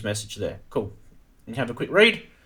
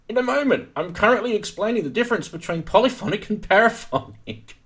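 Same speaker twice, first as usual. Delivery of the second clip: high voice; 8 semitones higher than usual. Acted. Meme quote, geeky partner.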